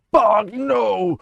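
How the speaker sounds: a high, loud panicked cry; panicked shout. Real, not acted. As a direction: yell it as the monster catches you. Caught by Steve in game.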